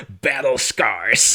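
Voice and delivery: slow, put-on Southern accent on battle scars; Southern drawl impression. Jasper's battle-scars line in a slow Southern accent. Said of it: "And he's like".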